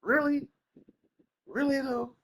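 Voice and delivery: high-pitched disbelief; incredulous. Real, not acted. Reacting to the live service news, high and incredulous. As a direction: question it high, as if you can't believe it.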